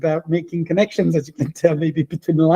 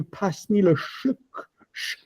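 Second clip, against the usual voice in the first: put-on Normandy accent; 6 dB quieter, slower than usual. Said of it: "the person might still say to you".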